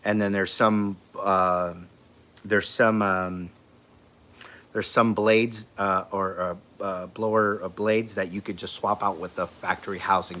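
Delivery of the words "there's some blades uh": normal talking voice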